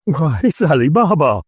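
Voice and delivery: high, thrilled cry of recognition; ecstatic exclaim. A narrator's reading. Old man delighted at a childhood memory, ecstatic cry. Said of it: "Scrooge exclaimed in ecstasy".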